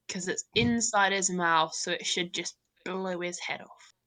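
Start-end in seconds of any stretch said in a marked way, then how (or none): none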